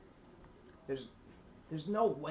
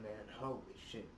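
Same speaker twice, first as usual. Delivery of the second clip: ordinary talk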